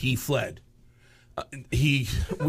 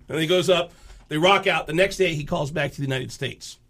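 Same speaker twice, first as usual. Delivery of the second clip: ordinary delivery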